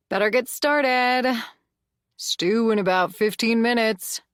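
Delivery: Zach's voice. A narrator's reading. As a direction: call it out over your shoulder. Zach voiced by narrator.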